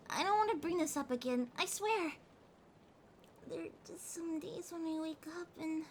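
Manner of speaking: ordinary talk, nothing marked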